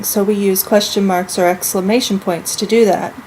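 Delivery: ordinary talk, nothing marked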